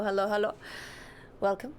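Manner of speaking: ordinary talk, nothing marked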